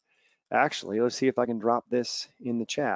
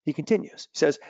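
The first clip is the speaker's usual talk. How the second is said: normal talking voice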